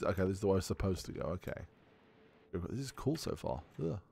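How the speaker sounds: ordinary delivery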